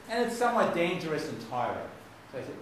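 ordinary talk, nothing marked

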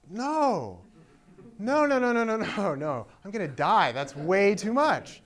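high, loud string of refusals; loud, pleading, alarmed refusal; panicked protest. Retold or imitated. Acts out his protest to God.

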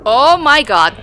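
loud, high burst of shock; shocked exclamation. Real, not acted. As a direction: blurt it out, caught off guard. Sudden shock at game event.

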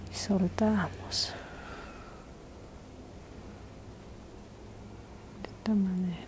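soft ASMR whisper; intimate whisper. Acted. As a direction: breathe the words gently, close to the mic. ASMR massage, whispered guidance.

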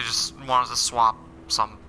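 ordinary talk

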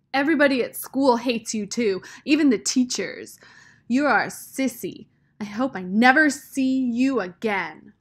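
heated, loud, hateful outburst; angry outburst. A narrator's reading. Hurt girl lashing out at Rob.

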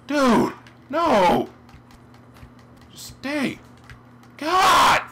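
very high, loud protests; exasperated shouting. Real, not acted. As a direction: yell at the game in frustration. Yelling at the game going wrong.

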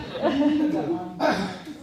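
a short, high burst of laughter; bright laugh. Real, not acted. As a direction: laugh it off, a bit embarrassed. Laughing at own greeting.

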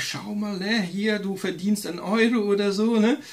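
high, coaxing tempter voice; sweet-talking devil urging him to keep the money; devil's voice. Retold or imitated. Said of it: "dann kommt schon der Teufel und sagt".

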